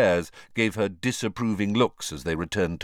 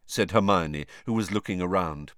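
usual speaking voice

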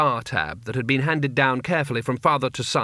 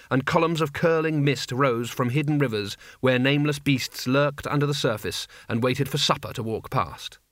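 ordinary delivery

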